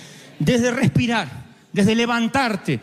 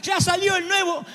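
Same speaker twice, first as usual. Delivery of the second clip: put-on spoiled child shouting; 12 semitones higher than usual. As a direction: shout like a child who must have the new phone. Child demanding new iPhone. (hijo exigiendo el iPhone, grito agudo)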